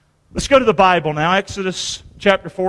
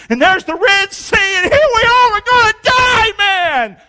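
mock panic; 12 semitones higher, 4 dB louder, slower than usual. Preacher acting out trapped Israelites.

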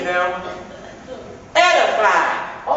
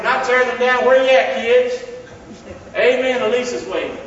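ordinary talk, nothing marked

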